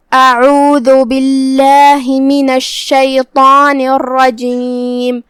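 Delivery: slow, measured tajweed chant; sustained, level-toned recitation; Quran recitation. Acted. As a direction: recite slowly with long drawn-out vowels.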